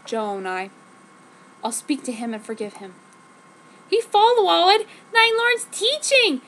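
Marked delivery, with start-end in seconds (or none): 3.9-6.4 high, loud, slow wail; slow anguished high outcry; crying out. Acted. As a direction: cry it out in anguish at him. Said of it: "I cried".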